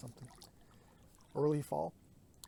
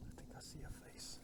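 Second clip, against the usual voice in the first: whisper; 14 dB quieter than usual. Real, not acted. Whispering, spotting something in the distance.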